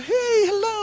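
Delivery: very high, put-on greeting; gushing wife. Preacher as soldier's wife on video call, gushing. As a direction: pitch it way up, cooing hello. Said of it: "istrinya video call".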